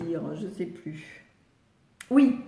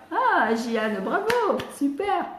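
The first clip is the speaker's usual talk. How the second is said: high-pitched, delighted congratulations; excited bravo; delighted praise; 5 semitones higher, slower than usual. Real, not acted. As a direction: cheer the pupil on, voice leaping up. Praising a child who succeeded.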